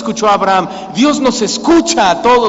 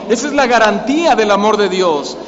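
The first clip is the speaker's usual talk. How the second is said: ordinary talk, nothing marked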